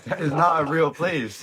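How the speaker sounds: laughing, high and slow. Real, not acted. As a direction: laugh through the words. Laughing disbelief at friend's claim.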